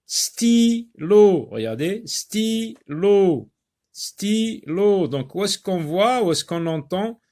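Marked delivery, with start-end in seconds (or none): 0.1-1.4 "Stylo" split sty-lo, high and loud; teacher dividing "Stylo" into sty... lo; syllable by syllable. Shown as a demonstration. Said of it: "diviser le mot en syllabes". 2.1-5.1 "stylo" split sty-lo, pitched high; teacher dividing "stylo" into sty... lo; syllable by syllable. Shown as a demonstration. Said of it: "diviser le mot en syllabes".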